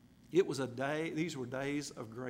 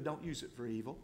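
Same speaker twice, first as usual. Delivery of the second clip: usual speaking voice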